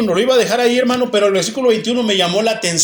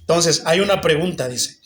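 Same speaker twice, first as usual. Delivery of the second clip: ordinary delivery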